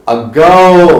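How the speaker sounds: loud, drawn-out ago as "uh-GOOO"; demonstrated pronunciation. Shown as a demonstration. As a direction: stretch the second syllable of ago loudly. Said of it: "it is ago".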